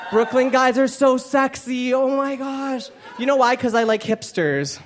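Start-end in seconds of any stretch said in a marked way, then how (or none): 0.1-2.9 swooning. Real, not acted. Swooning over Brooklyn guys.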